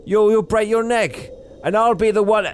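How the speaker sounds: shouting. Retold or imitated. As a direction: bellow it across a snowy roof. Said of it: "she shouts, yelling".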